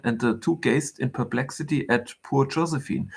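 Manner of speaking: ordinary delivery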